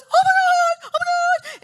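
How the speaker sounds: very high, loud mock scream; hammed-up ecstatic shriek; freaking out. Imitating the lady greeting them at breakfast, freaking out. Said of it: "She's gonna freak out".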